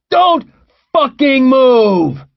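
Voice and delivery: loud, high, drawn-out yell; distant shouted command. A narrator's reading. Gunman sprinting after fugitives, distant shouted command. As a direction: bellow it slowly from down the street.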